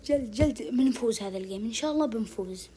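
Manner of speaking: usual speaking voice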